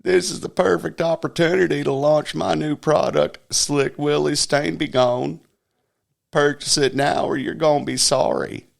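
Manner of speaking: mock Clinton hawking a product; Clinton impression. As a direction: pitch a product like a sleazy salesman. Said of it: "President Clinton said".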